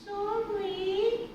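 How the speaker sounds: high, drawn-out sorry; sheepish alligator. A narrator's reading. Alligator apologises to the spider, sheepishly.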